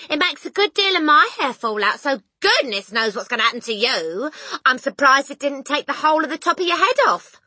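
high, loud scolding prediction; loud warning. A narrator's reading. Mother warning husband about hair loss, loudly. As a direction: rub it in at full voice.

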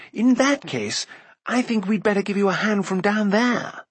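high, lilting storybook call; calling back. A narrator's reading. Bard offering a hand from above, calling back. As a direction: pitch it up and call down to them. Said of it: "Floodor called back".

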